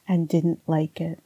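normal talking voice

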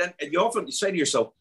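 ordinary delivery

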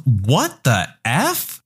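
slow, drawn-out disbelief; mock-stunned and slow; incredulous. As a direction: stretch each word in stunned disbelief. Student's silent mouthed disbelief, voiced.

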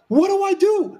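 high, frightened whine; panicking husband asking what to do; mock panic. Husband asks wife at the funeral, high mock panic. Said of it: "And he's like".

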